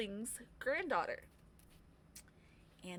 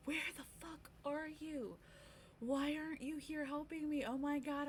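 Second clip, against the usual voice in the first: freaking out; 5 semitones higher, 4 dB quieter than usual. Retold or imitated. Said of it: "I freaked out during the party".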